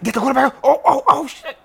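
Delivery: ordinary talk, nothing marked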